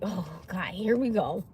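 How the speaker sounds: low, hushed groan to oneself; under-the-breath exasperation; weary dread. Retold or imitated. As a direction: drop low and soft, resigned. Dreading what mother starts.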